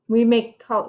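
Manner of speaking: ordinary delivery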